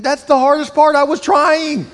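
high, loud anguished cry; despairing son. Retold or imitated. Son crying out to his dad, high and anguished. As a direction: it hurts that he tried.

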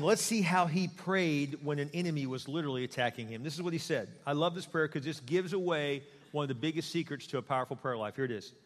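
ordinary delivery

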